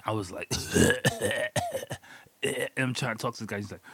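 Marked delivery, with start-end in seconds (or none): 0.6-2.0 imitated high, choking cough; hard coughing fit. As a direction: act out hacking on smoke. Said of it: "I fucking coughed so hard".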